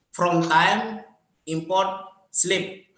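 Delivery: slow, spelling out code words; slow dictation. Shown as a demonstration. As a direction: read the code line out slowly. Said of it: "dictating a code line".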